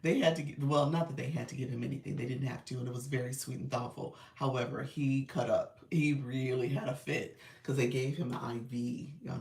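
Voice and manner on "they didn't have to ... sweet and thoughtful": ordinary talk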